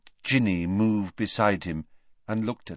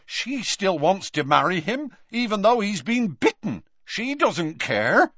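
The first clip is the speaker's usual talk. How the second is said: bitter reproach; 10 semitones higher than usual. A narrator's reading. Tonks glaring at Lupin.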